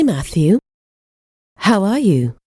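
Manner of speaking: ordinary delivery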